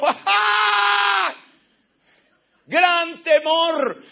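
high, loud, drawn-out cry; a loud, slow wail of great fear. Retold or imitated. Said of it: "Él pegó un grito y dijo".

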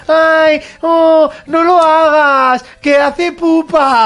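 mock a pampering parent, slow and sweet. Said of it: "si no se lo dices".

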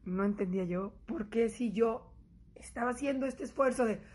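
usual speaking voice

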